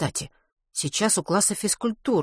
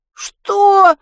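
shocked; 10 dB louder than usual. A narrator's reading. Shocked boy.

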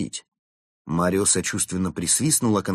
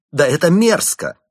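high, loud disgusted outburst; angry; 10 semitones higher, 10 dB louder than usual. Acted. Chimp monk outraged by the plot against the girl, loud. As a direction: spit out the disgust. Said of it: "прорезались злость и отчаяние".

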